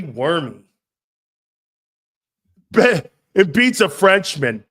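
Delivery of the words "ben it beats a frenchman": loud slow outburst; loud, slowed rant; excited rant. Real, not acted. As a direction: hammer the words out. Host rants about Frenchmen.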